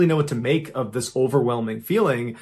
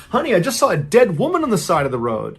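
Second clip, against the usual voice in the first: higher-pitched, urgent storyteller's wife voice; narrated alarm, raised pitch; 6 semitones higher, 4 dB louder than usual. A narrator's reading. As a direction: blurt out the shocking sighting, pitched up. Wife reports finding a body.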